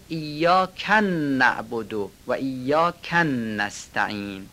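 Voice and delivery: ایاک run into a doubled n, "iyyakannabodo"; extra wrong shaddas. Shown as a demonstration. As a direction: double the n starting نعبد and نستعین.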